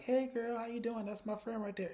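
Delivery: high-pitched gushing hello, put on; mock friendly greeting. Panelist breaking off to greet a friend, mock friendly. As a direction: imitate calling out to a friend.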